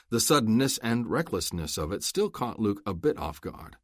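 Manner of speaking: usual speaking voice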